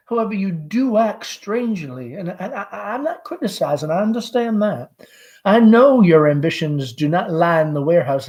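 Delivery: high nervous Southern mother; anxious mother Amanda. Acted. Amanda voiced by reader.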